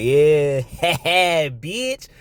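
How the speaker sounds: a hint of a mocking woman's greeting; lightly voiced woman, laughing through it. Streamer imitating a woman greeting a friend, mocking.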